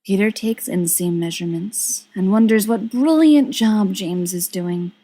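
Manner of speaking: plain reading voice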